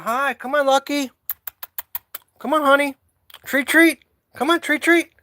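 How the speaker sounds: high, loud calling out to the cats; high-pitched cat-calling voice. Real, not acted. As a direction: call them in, high and bright.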